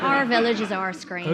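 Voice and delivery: Northern Irish lilt, laughing through it; a quick laughing Belfast take; Northern Irish accent with a laugh. Shown as a demonstration. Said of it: "north of Ireland".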